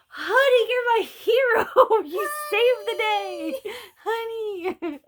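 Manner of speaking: gushing thanks. Retold or imitated. Thanking husband as her hero.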